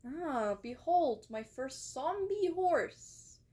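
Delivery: slow, drawn-out showman's announcement; grand mock reveal. Streamer shows chat a first zombie horse, mock-grand. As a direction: proudly unveil it, stretching words, pitch swooping up.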